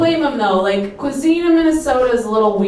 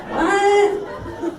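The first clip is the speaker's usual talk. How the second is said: incredulous; 11 semitones higher than usual. Real, not acted. Incredulous reaction.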